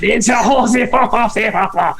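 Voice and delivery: babbled mock French; French-accented baby talk. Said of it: "like, a French accent".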